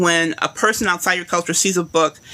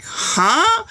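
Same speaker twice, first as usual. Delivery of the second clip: high, rising puzzled huh; bewildered question, voice leaping up; baffled; 11 semitones higher than usual. Retold or imitated. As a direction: pitch it up sharply in confusion. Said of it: "I was like".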